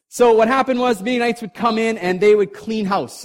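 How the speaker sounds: building excitement about the raiders; excited storytelling. A narrator's reading. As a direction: raise it as the raiders pour in. Dramatic story climax.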